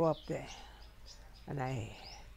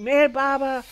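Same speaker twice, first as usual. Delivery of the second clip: loud calls of the name, higher in pitch; retold shouting; 8 semitones higher, 8 dB louder than usual. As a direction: shout after the runaway rider. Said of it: "they keep on shouting".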